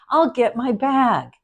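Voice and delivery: loud, slow, cheerful I'll get my bag; eager bait and switch. Shown as a demonstration. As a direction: sound thrilled, then land it. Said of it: "the first alt that would have made me laugh".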